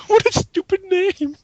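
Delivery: high, laughing scorn; laughing mockery. Real, not acted. Mocking the fan villain's name, laughing. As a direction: laugh it out in disbelief.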